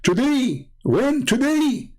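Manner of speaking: high, frantic disbelief; hammed-up fright, very high; mock panicked questions. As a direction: gasp it out in panic. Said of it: "Muhammad is scared".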